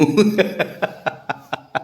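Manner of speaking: soft airy laugh; breathy laugh. Real, not acted. Father laughing softly at his pet's drama. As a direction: laugh quietly under your breath.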